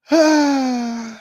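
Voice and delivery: a long, loud weary sigh; loud exasperated sigh. Real, not acted. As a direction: let out the air in disbelief. Reaction to the answer.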